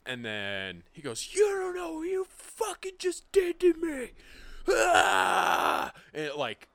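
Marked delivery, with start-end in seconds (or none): 1.3-4.1 very high, loud, drawn-out mock scream; Hulk-mode rage. Kid whose pills were burned, screaming mock rage. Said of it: "goes full on Hulk mode". 4.7-5.9 long, loud imitated rage scream; high, voiceless roar at full blast; Hulk-mode scream. Kid watching pills burn, long mock rage scream. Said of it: "goes full on Hulk mode".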